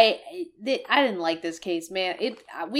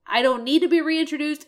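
usual speaking voice

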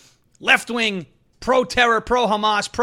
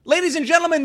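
ordinary talk, nothing marked

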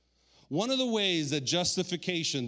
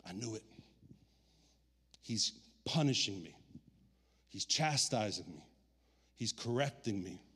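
low, hushed acted defeat; resigned self-blame; 6 semitones lower, 7 dB quieter than usual. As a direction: say it softly, as if accepting punishment. Acts out self-blame when tragedy hits.

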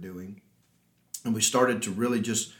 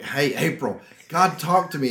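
retold excited announcement, higher and louder; 5 semitones higher, 6 dB louder than usual. Husband retells coming home to tell wife, excited. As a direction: burst in with big news, re-enacted. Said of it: "I came home and I'm excited".

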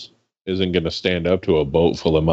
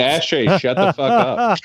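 mock yell; 9 semitones higher than usual. Real, not acted. Yelling at friend jokingly.

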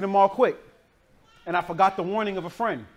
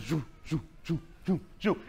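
soft rhythmic whooshes; imitated dog movement noise; 2 semitones lower, 7 dB quieter than usual. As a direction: mimic a Rottweiler coming, in soft spaced bursts. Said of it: "this, this Rottweiler".

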